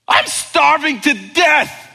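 put-on high, loud whining child; whiny kid. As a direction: mimic a dramatic kid. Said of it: "kids who use this phrase".

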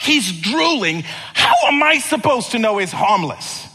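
protest, half panicked; exasperated. Retold or imitated. Runner retorts to owner.

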